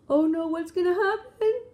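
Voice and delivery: loud high gasp and worried oh no; dramatic gasp. Acted. Parent reading the egg flying off to a child, dramatic alarm. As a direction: gasp as if the egg will smash.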